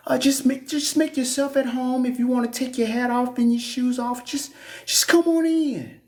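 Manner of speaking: high sugary welcoming voice; fake church greeter. Church greeter faking welcome to a visitor, high sugary voice. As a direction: gush over the visitor falsely. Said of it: "Same folks be gossiping about you".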